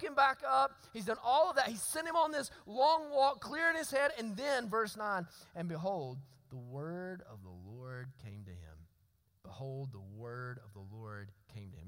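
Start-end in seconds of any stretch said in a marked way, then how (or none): 5.5-11.8 solemn scripture reading. A narrator's reading. Preacher reading verse nine to the congregation, solemn and hushed. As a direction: drop low and soft, weighing the verse.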